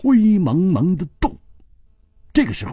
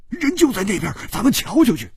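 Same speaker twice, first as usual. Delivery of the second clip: put-on higher, eager young man; keen, pushing to go look; voiced as brash Hu Tiehua; Hu Tiehua's voice; 10 semitones higher, faster than usual. A narrator's reading.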